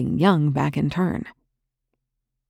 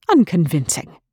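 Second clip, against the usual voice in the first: one sharp, louder word; cracked like a whip; 3 semitones higher, 5 dB louder than usual. Acted. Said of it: "cracked the word like a whip".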